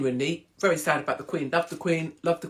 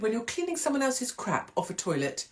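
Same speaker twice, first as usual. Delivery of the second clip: ordinary delivery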